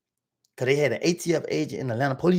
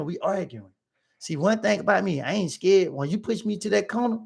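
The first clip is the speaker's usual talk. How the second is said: normal talking voice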